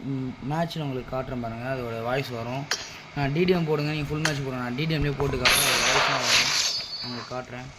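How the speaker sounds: ordinary talk, nothing marked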